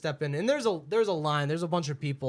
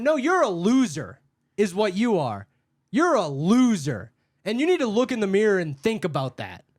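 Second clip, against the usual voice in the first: louder, higher scolding insult; going hard; 4 semitones higher, 7 dB louder than usual. Retold or imitated. Worker insulting the customer back, hard-hitting telling-off. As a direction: lay into her with emphatic contempt. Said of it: "I went hard on her".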